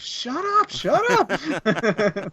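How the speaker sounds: high squeaky put-on brush-off; mock Martin. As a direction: parrot him in a silly high voice. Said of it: "But then he goes".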